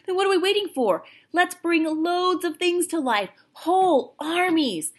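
thrilled shout, slowing on whole armies; excited. Acted. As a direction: burst out with boyish glee. Patrick's sudden excitement over toys.

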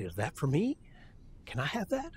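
soft question, lilting upward; child's hopeful asking. Acted. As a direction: lightly imitate a grandchild asking for a treat. Acts grandchild asking for a treat.

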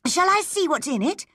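high-pitched, anxious question; urgent. Acted. Anne asking to open Matthew's letter, urgent.

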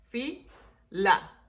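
"Fila" split into fi, la; syllable by syllable. Shown as a demonstration. Teacher counting two syllables, "Fila" broken fi... la. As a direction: pause between fi and la in "Fila". Dos sílabas.

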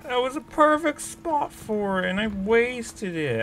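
high, slow, drawn-out whine; whining lament. Real, not acted. Player lamenting the wasted perfect spot, high whine.

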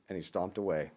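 ordinary delivery

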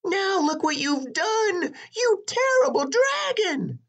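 very high, indignant voice; scolding princess. A narrator's reading. Princess blaming the dragon, high-pitched scolding. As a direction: scold him in a shrieky high voice. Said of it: "said the princess".

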